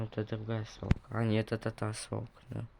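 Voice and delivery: ordinary talk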